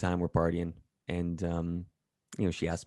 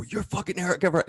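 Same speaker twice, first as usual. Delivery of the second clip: excited fan; 11 semitones higher, faster than usual. Retold or imitated. Fans recognize him excitedly.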